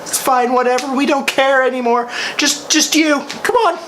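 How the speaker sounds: high, breezy dismissive call; mock bored host. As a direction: wave them off like you don't care. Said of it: "and goes".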